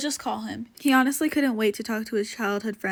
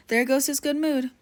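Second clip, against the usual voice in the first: ordinary delivery, nothing marked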